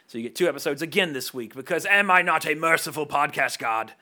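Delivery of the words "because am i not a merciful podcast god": mock grandiose. Grandiose joke boast.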